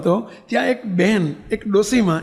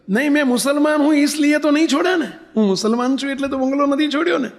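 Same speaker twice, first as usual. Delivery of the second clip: put-on old woman protesting; 6 semitones higher than usual. Old woman protests her religion.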